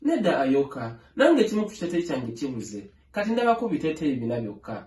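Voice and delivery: ordinary delivery, nothing marked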